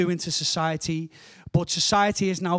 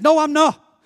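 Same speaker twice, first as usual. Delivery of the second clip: loud, high-pitched comic protest; exasperated retort; 10 semitones higher, 10 dB louder, slower than usual. Acted. Lost driver arguing with his sat-nav, loudly exasperated. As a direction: argue back at full voice in mock outrage.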